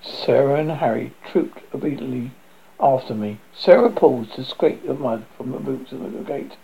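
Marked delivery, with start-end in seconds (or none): none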